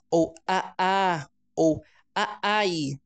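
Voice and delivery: "aá" and "aai" as bare vowel sounds; a child's vowel-only attempt at mamãe. Shown as a demonstration. Teacher imitates a child using only vowels. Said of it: "só usando as vogais".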